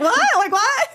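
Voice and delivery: high, loud baffled what; high shocked disbelief. Retold or imitated.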